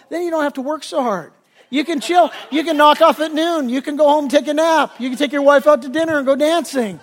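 high, loud, gleeful listing; hammed-up enthusiasm, oblivious to the irony; mock triumphant pitch. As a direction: build to the punchline like an excited salesman. Businessman triumphantly delivers the punchline.

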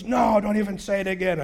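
loud, high refusal; acted protest. Biker refusing to hear pastor, loud indignant protest. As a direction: shut him down in mock outrage.

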